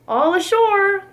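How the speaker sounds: high bright call; shouted. A narrator's reading. Sailors reaching New York, high shout. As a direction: call the landing to everyone. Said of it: "the men shouted".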